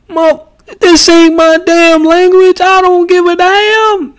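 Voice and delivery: very high, loud, comic rant; Chris Tucker voice. Pretend. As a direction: go way up high and loud like a comedy star. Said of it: "In my Chris Tucker voice".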